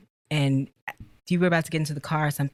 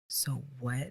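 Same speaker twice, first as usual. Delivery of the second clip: mock irritated sigh; 5 semitones lower, 15 dB quieter than usual. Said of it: "when I know he's irritated".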